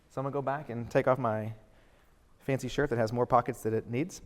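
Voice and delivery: ordinary talk, nothing marked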